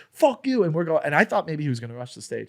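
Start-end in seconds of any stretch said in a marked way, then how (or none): 0.1-0.6 heckler's high, loud retort; retold heckler shout. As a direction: yell it back as the heckler. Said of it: "he goes".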